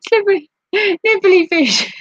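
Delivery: giggling helplessly over the name; laughing. Real, not acted. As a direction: squeal it out while laughing.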